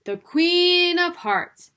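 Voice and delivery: slow, high, loud proclamation; herald announcement. A narrator's reading. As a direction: announce the Queen grandly.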